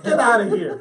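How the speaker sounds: loud, high comic yell; mock angry dismissal. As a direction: throw her out, half laughing. Husband dismisses wife, acted.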